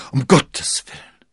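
loud, high, aghast exclamation; horrified cry. A narrator's reading. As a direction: cry out in sudden dread. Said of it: "schrie er".

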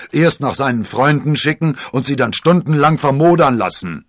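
loud, high, indignant rant; blustering. A narrator's reading. Porthos scolding D'Artagnan for the long wait, blustering. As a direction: thunder out the complaint. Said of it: "polterte Porthos los".

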